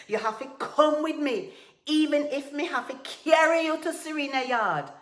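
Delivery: high, very loud insistence; angry shout. A narrator's reading. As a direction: shout the order, brooking no refusal. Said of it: "Tiger shouted".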